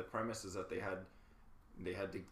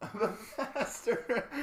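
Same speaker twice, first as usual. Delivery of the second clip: laughing; 12 semitones higher, 6 dB louder, much slower than usual. Real, not acted. Laughing joke about pastor role.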